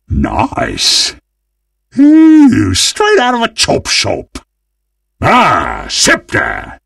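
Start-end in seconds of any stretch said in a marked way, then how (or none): 2.0-4.4 big thrilled ooh; delighted ooh. Acted. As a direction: coo loudly with greedy delight. Delighted at a new item.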